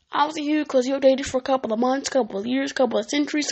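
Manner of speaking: ordinary talk, nothing marked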